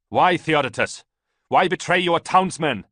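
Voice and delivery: loud, high demand across the fighting; shouted question. A narrator's reading. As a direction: yell the accusation over the combat. Ballista shouts across the battle.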